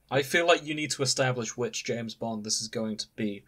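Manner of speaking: ordinary talk, nothing marked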